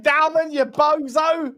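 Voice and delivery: ordinary delivery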